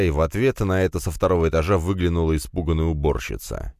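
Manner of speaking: plain reading voice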